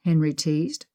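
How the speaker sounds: ordinary delivery, nothing marked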